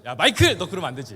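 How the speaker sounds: loud, high yell of the name; scolding shout. Retold or imitated. Pastor scolding Michael for teasing, loud shout. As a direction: bellow at the boy to stop. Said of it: "제가 혼냈죠".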